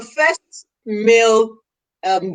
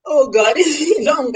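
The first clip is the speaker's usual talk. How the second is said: laughing; 6 semitones higher, 3 dB louder than usual. Real, not acted. Delighted host laughing.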